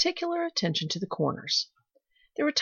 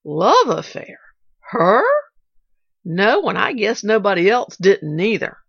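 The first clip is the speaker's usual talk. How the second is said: louder, astonished disbelief; incredulous; 2 semitones higher, 8 dB louder than usual. A narrator's reading. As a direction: maid hearing of Miss Polly's romance. Nancy incredulous.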